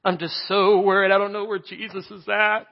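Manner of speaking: high-pitched, hammed-up tearful worry; playful imitation of a weepy, worried woman; mock crying worry. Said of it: "I could just imagine her crying".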